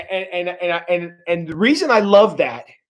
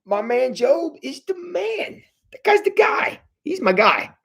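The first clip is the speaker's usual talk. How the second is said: proud casual God; 8 semitones higher, 3 dB quieter than usual. Pretend. Preacher voicing God boasting about Job, lightly beaming. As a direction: play God bragging about his man. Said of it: "Yet God is going".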